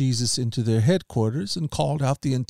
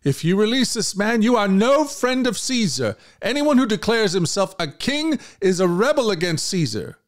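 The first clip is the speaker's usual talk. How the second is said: much higher, forceful outcry; leaders shouting; 9 semitones higher than usual. Acted. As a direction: shout the threat up high.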